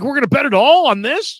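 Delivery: imitating a brother's high, loud disbelief; incredulous question. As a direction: balk loudly, voice shooting up, hammed-up. Brother balks at betting all money.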